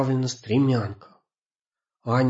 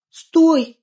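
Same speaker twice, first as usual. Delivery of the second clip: high, loud scared cry; frightened shout; 8 dB louder than usual. Acted. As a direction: scream at him in fright. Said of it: "испуганно крикнула Аня".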